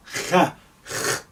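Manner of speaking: Kha, kh breathed from the back of the throat, unvoiced; throaty kh sound. Shown as a demonstration. Teacher isolating the Arabic kha sound, breathy. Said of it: "K H together is representing kha".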